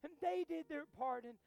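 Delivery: earnest, phrase-by-phrase prayer with long pauses; measured opening growing into fervent prayer; public prayer. Real, not acted. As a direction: pray aloud to God for the congregation. Leads the congregation in prayer.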